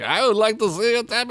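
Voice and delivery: high-pitched attempt at a Scottish accent; bad Scottish accent. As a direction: quote the film in bad Scottish. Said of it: "I can't do Scottish accent".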